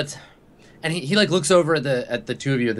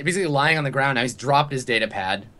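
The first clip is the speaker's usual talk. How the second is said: normal talking voice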